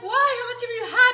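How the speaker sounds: a hint of his alarmed question; partner's voice. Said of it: "And he's like".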